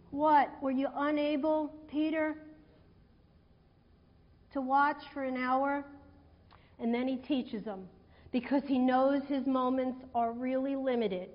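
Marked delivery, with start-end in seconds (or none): 0.1-5.8 soft, quiet question to Peter; gentle, not yelling. Shown as a demonstration. Jesus asking Peter gently, softly, not in all caps. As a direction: ask it kindly, as if not wanting to scold. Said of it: "He didn't come and yell at them in all caps".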